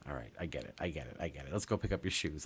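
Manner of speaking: low, soft placating voice; calm acceptance. Retold or imitated. Mom to upset son after pickup, low soft reassurance. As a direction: imitate a mom gently giving in.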